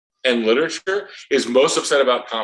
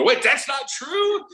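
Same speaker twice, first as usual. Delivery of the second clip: high, slow disbelieving question; retold kids' surprise; 14 semitones higher, much slower than usual. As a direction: sound like a kid caught off guard. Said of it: "And they go".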